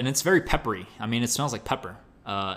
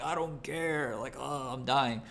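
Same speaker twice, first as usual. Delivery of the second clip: exhausted groan; 4 semitones higher, 5 dB quieter, slower than usual. Retold or imitated. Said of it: "And I'm like".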